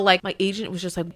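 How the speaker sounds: ordinary talk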